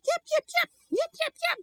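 very high tiny yips; cartoon puppy chirping; squeaky puppy voice. A narrator's reading. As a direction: yip like an excited little puppy. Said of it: "Gizmo woke up and went".

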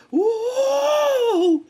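very high, loud, drawn-out "woo"; imitated big rush whoop; wild whoop. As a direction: let out a piercing whoop. Imitates a very strong hit.

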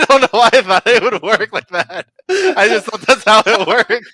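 high, loud, laughing through the words; helpless, high-pitched laughing speech; laughing while talking. Real, not acted. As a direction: crack up at your own dumb idea. Laughing at own balloon idea.

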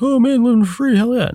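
hammed-up high-pitched cheer; mock celebration. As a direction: whoop it up like a carefree freeloader. Mocks the idea of freeloading.

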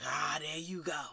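low, very soft put-upon groan of a reply; mock weary complaint. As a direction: mimic someone fed up, under your breath. Mimics partner tired of God talk.

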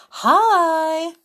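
high, loud, drawn-out hello; cheery greeting. Shown as a demonstration. Storyteller shows listeners what to send, cheerily. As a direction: call it out brightly to a friend.

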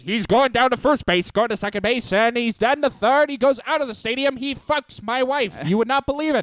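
put-on high old-timey Mid-Atlantic showman; Mid-Atlantic sideshow hype; Mid-Atlantic accent. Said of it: "best Mid-Atlantic impression".

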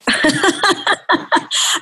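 a short embarrassed giggle; stifled giggles. Real, not acted. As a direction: giggle breathily, caught off guard. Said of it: "I'm laughing because".

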